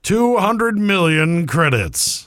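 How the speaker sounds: slow, booming prize reveal; game show host. Acted. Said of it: "We're gonna do Game Show [name] now".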